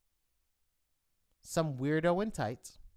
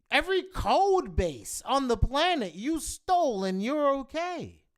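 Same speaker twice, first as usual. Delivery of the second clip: higher and louder, ranting at full blast; ranting shout; 9 semitones higher, 6 dB louder than usual. Real, not acted. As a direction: hammer the accusation home, yelling. Ranting climax of the tirade.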